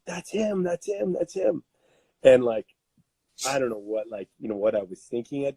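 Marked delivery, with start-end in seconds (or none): 0.1-1.6 high, excited mock yell of onlookers; pointing and calling out, lightly acted; lightly voiced neighbours crying out. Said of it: "see them start yelling at me".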